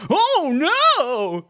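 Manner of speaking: high squeaky fake cry of alarm; mock NPC panic. Retelling a game NPC's reaction, high mock panic. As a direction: squeal it like a cartoon villager. NPC voiced in gaming anecdote.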